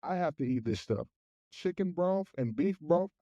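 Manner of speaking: usual speaking voice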